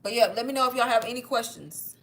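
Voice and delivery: normal talking voice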